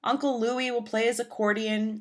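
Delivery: ordinary delivery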